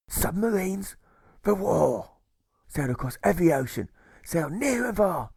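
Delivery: put-on old sea dog reminiscing fondly; pirate jailer recalling life at sea; wistful old pirate.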